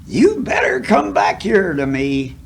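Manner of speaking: high hollered call of a school teacher; a teacher calling loud across the schoolyard; retold holler. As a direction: yell after the runaway boy. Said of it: "I can hear that school teacher hollering yet".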